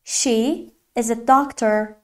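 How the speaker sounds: very slow, separated English words; careful teacher's slow demonstration; slow model sentence. Shown as a demonstration. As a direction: model it word by word for learners.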